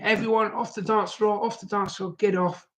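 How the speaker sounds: high, held-back imitation of calling out; mock calling out. Sister ordering everyone off the dance floor, high mock call.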